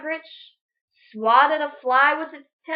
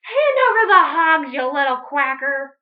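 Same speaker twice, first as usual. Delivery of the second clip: high, loud demand; bully the duck; screeching witch; 4 semitones higher, 7 dB louder than usual. Acted. Witch Grinch demanding the hogs, high and loud. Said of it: "Grinch screeched".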